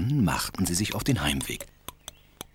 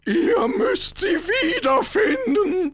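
crying; 6 dB louder, much slower than usual. Acted. King cries for daughter.

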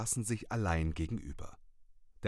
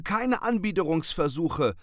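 high, loud and stern; 9 semitones higher, 7 dB louder than usual. Acted. Cat rejecting the raven, stern, high and loud. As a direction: cut him off sternly. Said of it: "antwortete Maurizio streng".

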